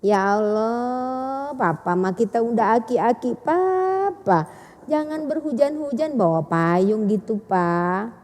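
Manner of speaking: soft, coaxing plea to a husband; drawn-out, tender chiding instead of scolding; gentle wording. Acted. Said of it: "Bahasa lembut napa".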